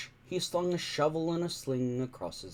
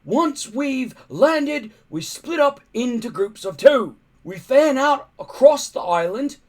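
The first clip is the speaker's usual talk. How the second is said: much higher, louder barked orders; booming chief; 9 semitones higher, 9 dB louder than usual. A narrator's reading. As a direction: bellow like a big Viking chief. Stoic yelling orders to warriors.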